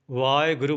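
ਵਾਹਿਗੁਰੂ said "vaae-guru" with no h; dropped h. Shown as a demonstration. Teacher imitating how some people misread it, ਵਾਹਿਗੁਰੂ without h. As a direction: run aa straight into e in ਵਾਹਿਗੁਰੂ. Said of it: "ਵਾਹਿਗੁਰੂ ਬੋਲਦੇ ਨੇ".

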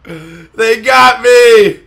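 loud, drawn-out, high wail; slow anguished shout. Real, not acted. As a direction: wail it out slowly. Caught in game.

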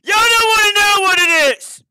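high, loud grossed-out yell; disgusted shout. Real, not acted. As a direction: yell it out, horrified.

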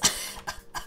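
soft voiceless wheeze of laughter; real wheezing laugh; breathless laugh. Real, not acted. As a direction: dissolve into an airy laugh. Child glitched across map.

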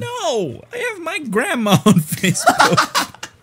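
high, laughing outcry; loud protest. Real, not acted. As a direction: refuse loudly, horrified and amused. Horrified refusal to share post.

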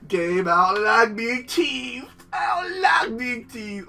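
slow, drawn-out theatrical whine; hammed-up hurt; mock whine. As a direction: pout at the game. Whining at game.

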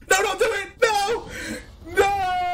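high loud pleading cries; desperate no. Real, not acted. Streamer pleads at the opponent's play, high and loud. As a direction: beg them not to do it. Begging opponent not to act.

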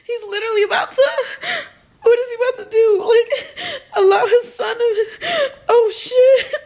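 crying. Real, not acted. Reader sobbing as the father condemns his son, crying loudly. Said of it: "Y'all saw me crying".

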